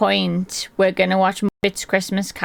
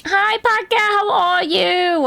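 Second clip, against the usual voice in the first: much higher, slow squeaky voice; silly high greeting; 10 semitones higher, slower than usual. Pretend. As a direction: squeak a cartoonish hello.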